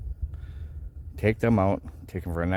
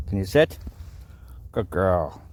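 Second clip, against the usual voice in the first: usual speaking voice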